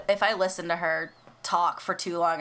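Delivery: ordinary delivery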